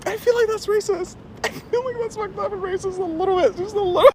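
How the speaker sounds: squeaky playful objection; high mock protest.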